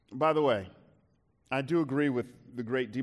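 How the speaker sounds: normal talking voice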